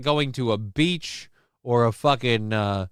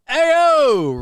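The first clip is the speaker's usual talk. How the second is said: loud, much higher hype call; hype shout; 7 dB louder than usual. Real, not acted. Hype reaction.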